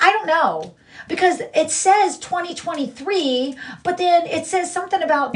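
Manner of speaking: a hint of a clueless woman's voice; mocking her rival. Mimicking the rival reading her court record, mildly mocking. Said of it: "and then she's like".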